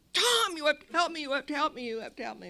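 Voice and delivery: pleading for help, dying down; desperate pleading. Retold or imitated. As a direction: beg at the door in panic. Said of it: "I'm banging on his door".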